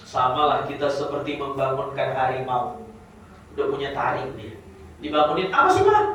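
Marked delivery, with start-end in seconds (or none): 5.5-6.0 high, loud whiny "Apa sih, Pak?"; grumpy grown child. Acted. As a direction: sulk like a teenager woken too early. Grown child grumbling at father. (anak besar menggerutu pada bapaknya, suara tinggi dan keras)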